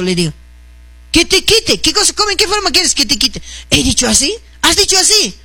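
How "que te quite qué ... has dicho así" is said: high, indignant retort; mock-indignant back-and-forth, pitched up; retold argument. Mother arguing with daughter about the prayer, high and indignant.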